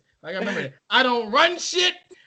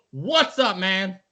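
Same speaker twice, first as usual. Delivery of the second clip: ordinary talk, nothing marked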